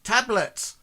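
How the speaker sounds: put-on loud and high, calling the boy over; barking shout. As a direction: snap the order across the room. Master yells at his servant boy.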